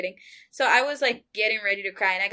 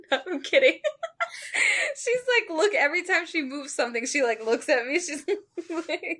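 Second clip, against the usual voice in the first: laughing; 6 semitones higher than usual. Real, not acted. Friend reacting across the room.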